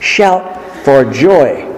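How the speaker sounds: "Shout for joy" stretched out very slowly, joy falling; slow emphatic reading. Shown as a demonstration. Preacher contrasting the psalm's wording for his flock, slow and emphatic. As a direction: savour every word of "Shout for joy". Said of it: "Make a joyful noise just doesn't do it".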